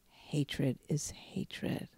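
hushed, slow, half-whispered quote; retold tearful plea. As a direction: softly imitate her grave, emotional message. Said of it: "she started to cry".

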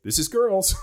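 high, resigned shrug of a line; lightly imitating a shrugging wife. Wife shrugging, voiced by dad.

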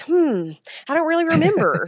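high-pitched mock pondering; imitated vague recollection. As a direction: imitate someone casually trying to recall. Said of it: "and they're like".

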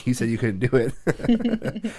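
slow words, laughing through them; laughing tease. Retold or imitated. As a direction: tease him while cracking up. Teasing son with a laugh.

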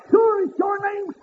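high ringing preacher's cry; preaching at full force. Acted. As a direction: lift it up and ring it out to the congregation. Preacher whooping, shout.

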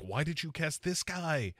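mock exasperated question. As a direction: plead with the director, baffled.

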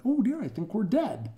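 high-pitched polite dismay; prim little "oh dear" realisation, pitched right up; proper English guest. Acted. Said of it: "but they're proper".

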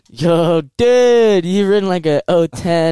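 loud, high surfer-bro voice; dude bro voice. Retold or imitated. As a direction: play a hyped dirt bike bro. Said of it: "How do you even know what they sound like when you say it like that".

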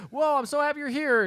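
high-pitched imitation of a gushing girl; mock delighted greeting. [name] greeting Paolo as he appears, mock delight. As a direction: gush it high, lightly hammed up.

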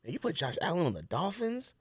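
ordinary delivery

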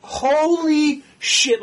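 high, drawn-out astonishment; stunned. Retold or imitated. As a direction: stretch the words out in retold shock. Retelling reaction to villain's reveal.

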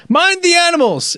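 put-on a quick high German-accented quip. Podcast host quoting Vettel in a German accent. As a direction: mimic a German racing driver's accent. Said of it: "in his hilarious German accent".

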